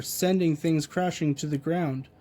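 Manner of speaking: ordinary delivery, nothing marked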